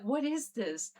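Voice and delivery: freaked out, but at normal volume; retold freak-out. Teller recalls facing the orb, mildly freaked out. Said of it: "I'm freaking out because I'm like".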